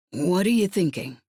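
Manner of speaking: wary. A narrator's reading. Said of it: "Radek's tone was wary".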